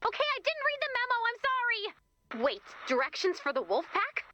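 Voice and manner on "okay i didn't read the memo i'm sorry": blurted confession. Acted. Blurts a confession under pressure.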